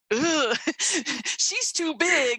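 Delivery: high, slow, laughing mock dismay; a giggling flinch away from a big idea; laughing recoil. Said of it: "my first initial response was".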